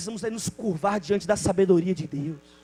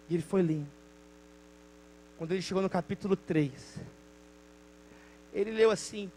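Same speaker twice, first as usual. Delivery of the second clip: ordinary talk, nothing marked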